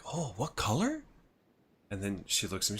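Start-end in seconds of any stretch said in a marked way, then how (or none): 0.1-1.0 high put-on voice, rising question; imitating curious woman. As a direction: mimic her eager surprise. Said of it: "And she's like".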